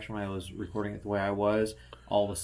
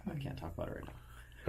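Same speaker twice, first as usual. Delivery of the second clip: secretive whisper; 4 semitones higher, 8 dB quieter than usual. Real, not acted. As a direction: keep it hushed like a secret. Secret guest stars.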